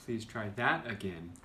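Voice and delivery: "that" lifted above the rest; stress on "that". Shown as a demonstration. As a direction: hit the word "that". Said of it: "different inflection on the words".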